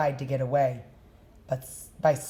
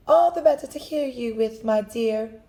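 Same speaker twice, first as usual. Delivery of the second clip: high, quick sly wolf voice; the wolf purrs a quick fake sweetness; wicked wolf; 7 semitones higher than usual. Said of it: "replied the wicked wolf".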